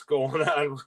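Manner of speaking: chuckling through the words; amused, laugh slipping out as you talk. Real, not acted. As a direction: break into a laugh mid-sentence. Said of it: "I shouldn't be laughing".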